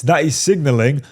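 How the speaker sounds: normal talking voice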